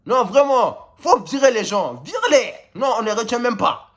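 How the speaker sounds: high, insistent outburst; exasperated demand. Real, not acted. Fan urging the coach to sack players, high and exasperated. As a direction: demand it, pitch leaping up.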